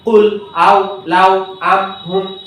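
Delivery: very slow, one word at a time; slow word-by-word reading; slow reading demo. Shown as a demonstration. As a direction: pronounce each word slowly without spelling. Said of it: "শব্দগুলো পড়বে".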